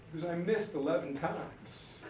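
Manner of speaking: soft pretend-weepy confession; mock tearful. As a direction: own up quietly, acting close to tears. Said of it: "It's why I'm crying".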